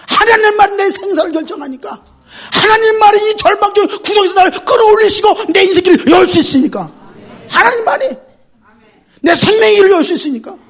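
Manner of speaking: high, loud crying-out plea; impassioned cry. Acted. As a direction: cry out with all your throat. Preacher cries out to God. (목청껏 부르짖듯)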